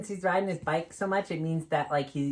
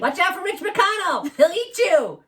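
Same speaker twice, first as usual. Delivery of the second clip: louder, higher jokey warning; mock alarm; 12 semitones higher, 8 dB louder than usual. Dog puppet warns the kids about a politician, in mock alarm. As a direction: shout a silly warning.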